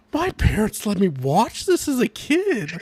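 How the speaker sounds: drawn-out, high-pitched disbelief; stretched-out, scandalized amazement; incredulous. Retold or imitated. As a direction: swoop up and down in mock shock. Acted disbelief at past viewing.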